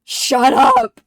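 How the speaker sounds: loud, exasperated snap; mock yell. Riddler shouting back at Ed, a loud mock yell. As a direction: bark it back in mock annoyance. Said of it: "Really goes".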